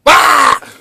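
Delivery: sudden high, loud yell; demonstrating intensity with a yell; freak-out yell. Shown as a demonstration. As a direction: burst out in a shout. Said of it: "Just freak out like that".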